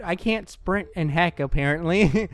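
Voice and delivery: ordinary talk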